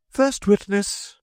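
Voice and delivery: high, slow herald's cry; "First witness!" drawn out high and loud like a trumpet call. A narrator's reading. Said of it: "called out".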